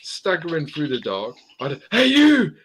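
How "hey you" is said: loud, high barked order; guard's shout. Retold or imitated. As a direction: yell it like a guard catching an intruder. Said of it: "I can't do the accent".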